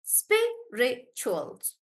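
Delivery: "Spirituals" stretched slowly in three beats; slow syllable read. Shown as a demonstration.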